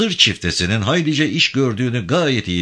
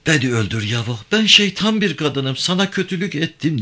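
high-pitched, mildly tearful woman's voice; tearful plea; 8 semitones higher than usual. A narrator's reading. Avke clinging to Yavan, confessing, tearful plea. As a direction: plead in a raised, lightly crying voice. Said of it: "diye ağlayarak canavarın boynuna sarıldı".

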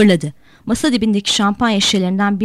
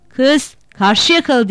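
put-on slow, loud, higher drunken shout; 5 semitones higher, 5 dB louder, much slower than usual. A narrator's reading. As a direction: stretch it out and bellow across the water. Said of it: "diye bağırdı". (sarhoş, ağır ağır bağırış)

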